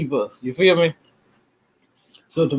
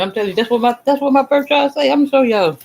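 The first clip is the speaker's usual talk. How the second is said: rising thrill, pushing it higher and higher; excited bragging; 9 semitones higher, 3 dB louder, faster than usual. Real, not acted. Telling viewers his birth chart proves it, excitedly higher. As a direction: pitch climbing much higher and louder as he gets worked up.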